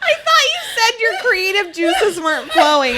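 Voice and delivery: shouting praise through laughter; laughing shout. Real, not acted. Cohost praising the skit, laughing shout. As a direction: laugh loud while praising.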